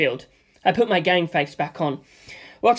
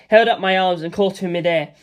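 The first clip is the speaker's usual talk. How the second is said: plain reading voice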